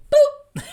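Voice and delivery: high, loud playful boop; silly meme sound effect; cartoon boop. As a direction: pop it like a cartoon bubble. Meme character pops drama.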